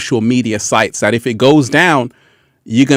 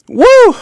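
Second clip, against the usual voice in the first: high loud whoop; excited woo; 6 dB louder than usual. Real, not acted. Host cheering the blackout with a high loud woo. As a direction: let out a big excited woo.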